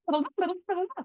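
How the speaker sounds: high excited gush; hammed-up excited squeal; gushing friend. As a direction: gush at them like an overeager friend. Mimics effusive friend.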